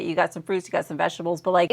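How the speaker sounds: normal talking voice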